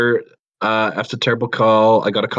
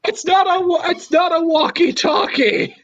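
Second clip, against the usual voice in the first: laughing shout. Real, not acted. Laughing outburst at the story.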